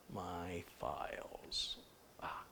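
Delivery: slow, very soft self-talk; absent-minded whisper. Real, not acted. Host hunting for files on a live stream, whispering to self. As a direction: trail into a whisper while searching. Muttering while searching for files.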